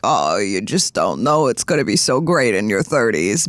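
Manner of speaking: a hint of a preachy elder; condescending oldie. Friend mocks what older people say, mild condescending voice. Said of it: "when oldies are like".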